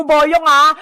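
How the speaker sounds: usual speaking voice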